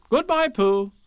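high, loud motherly farewell; Kanga's goodbye. Acted. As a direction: call it out in a high singsong.

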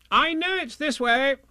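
high, insistent voice; cocksure Rabbit. A narrator's reading. Rabbit sure of the way, high and insistent.

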